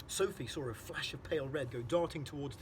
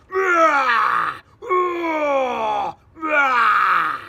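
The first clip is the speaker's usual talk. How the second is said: huge high roaring shout; giant's roar; 12 semitones higher, 22 dB louder, much slower than usual. Acted. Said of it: "roared the Flesh Lump Eater".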